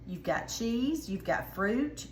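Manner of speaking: normal talking voice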